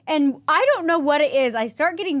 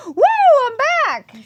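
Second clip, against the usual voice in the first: excited whoop; 10 dB louder than usual. Retold or imitated. Minty rub wakes her up.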